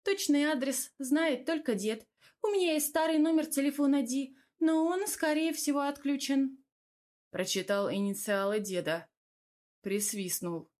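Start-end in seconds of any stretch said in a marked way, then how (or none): 0.0-6.6 higher-pitched female character voice; woman neighbour. Acted. Narrator voices Tamara.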